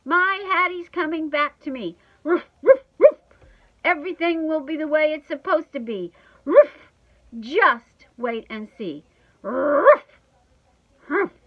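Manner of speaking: loud, high, insistent dog with barks; worked-up little dog insisting loudly; defiant shouting. A narrator's reading. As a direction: shout it out, punctuated with woofs. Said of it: "I shout".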